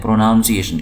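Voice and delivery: پروناؤنسیشن with "noun" in the middle; common mispronunciation. Retold or imitated. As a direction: say پروناؤنسیشن as pro-NOUN-si-ay-shun. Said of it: "بہت سارے لوگ پروناؤنسیشن بولتے ہیں". (پروناؤنسیشن میں "ناؤن" کی آواز کے ساتھ)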